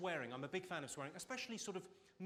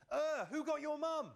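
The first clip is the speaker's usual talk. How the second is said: mock blurted question; 11 semitones higher, 6 dB louder, slower than usual. Husband's blunt reply quoted.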